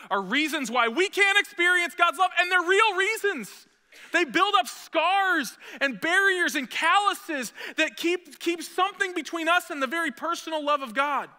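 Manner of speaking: loud, high, slowed preaching push; full-force preaching. Acted. Preacher raising the pitch and volume on scars and calluses, loud and high. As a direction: pastor pressing the congregation about barriers, preaching at full force.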